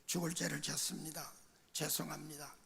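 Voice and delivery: soft, low confession, as if kneeling; contrite apology. Retold or imitated. Kneeling before the pastor to apologize and leave, hushed and contrite. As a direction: retell a humble apology very quietly, pitched low. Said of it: "목사님께 무릎 꿇고".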